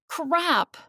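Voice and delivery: sharp high yelp of a curse; startled curse. Acted. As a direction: blurt it out as the drink spills. Drink spilled on her jacket.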